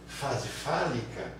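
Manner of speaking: "fase fálica" stretched very slow; slow key term. Shown as a demonstration. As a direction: spell out "fase fálica" slowly. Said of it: "naming the term slowly". (dizendo "fase fálica" bem devagar)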